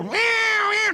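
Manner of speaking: high, squealing meow meow; imitated cat meows. Telling players about the cat, high mock meows. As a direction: mimic the crazed cat high up. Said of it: "the cat's still going crazy".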